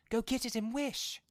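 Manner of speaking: high, loud trembling urge; shaking excitement. A narrator's reading. Wife urges husband, shaking with excitement. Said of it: "shaking with excitement".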